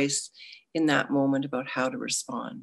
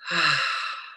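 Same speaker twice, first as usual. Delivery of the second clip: long audible sighing exhale; big sigh. Real, not acted. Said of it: "Take another big sigh".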